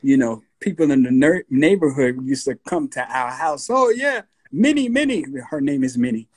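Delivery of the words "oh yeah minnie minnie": high, excited calling out; imitated neighbours. Neighbours greet his mom at the house, high calling voice. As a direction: call her name in a high pitch.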